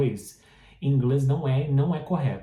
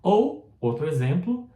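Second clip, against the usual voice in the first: ordinary delivery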